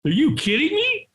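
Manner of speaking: high, mock-disbelieving retelling; retelling his shock at reaching the front. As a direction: relive the shock of being first.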